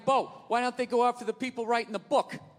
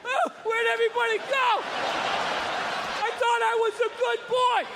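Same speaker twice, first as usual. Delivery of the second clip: much higher, plaintive and lost; mock bewildered old man; 12 semitones higher than usual. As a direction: plead like a confused old man left alone.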